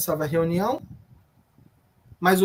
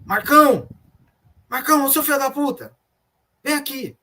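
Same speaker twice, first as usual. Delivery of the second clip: imitating a boss yelling for his guard, higher-pitched; retold angry yell; 5 semitones higher than usual. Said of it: "Ele começou a gritar assim". (grito imitado, meio contido)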